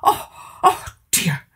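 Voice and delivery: dismayed cry. A narrator's reading. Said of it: "cried King Hamish".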